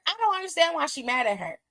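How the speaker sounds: ordinary talk, nothing marked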